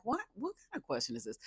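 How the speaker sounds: high, fast incredulous squeak; what kind of question is this. Retold or imitated. Recapping a dating show, mock disbelief. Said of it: "I was like".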